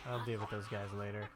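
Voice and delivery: usual speaking voice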